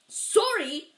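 loud, high bark of disbelief. A narrator's reading. Giant throwing the boy's apology back at him, barked. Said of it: "barked Hagrid".

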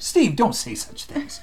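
mock-shocked listener scolding Steve; mock scandalized listener. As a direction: tease them as a shocked churchgoer. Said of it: "some of you would be like".

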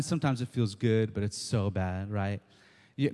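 normal talking voice

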